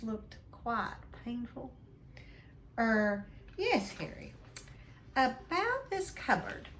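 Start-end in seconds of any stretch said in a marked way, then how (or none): 2.8-6.7 forced friendliness. Acted. Uncle faking kindness to Harry, high and strained. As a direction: sound painfully nice through a forced smile. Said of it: "forced his face into a smile".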